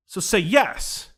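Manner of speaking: YES loud and drawn out; slow, loud retold plea; urging insistence. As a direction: push slowly and firmly on "yes". Urging the struggling man, retold.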